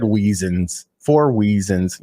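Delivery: "Weezins" with a W in place of the R; "Weezins" for reasons, drawn out; mispronounced reasons. Shown as a demonstration. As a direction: say "Weezins" slowly, owning the slip. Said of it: "I said Weezins".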